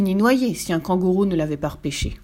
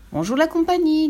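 high, sing-out hello to a group; cheery greeting; 9 semitones higher, 4 dB louder than usual. A narrator's reading. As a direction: hail everyone in a raised voice. Lou hails the gathered animals. (saluer joyeusement à la cantonade)

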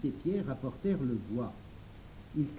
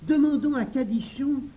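high, loud exclamation; crying out; 8 semitones higher, 6 dB louder than usual. A narrator's reading. Jacques proposes calling Cadichon to help, crying out. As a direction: burst out with the rescue idea. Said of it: "s'écria Jacques".

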